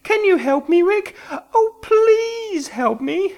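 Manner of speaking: high desperate woman begging for help. A narrator's reading. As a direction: beg Rick through tears. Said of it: "said Simone desperately".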